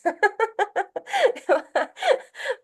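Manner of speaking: bright high burst of laughter. Real, not acted. Laughs at own skit.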